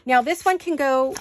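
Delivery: usual speaking voice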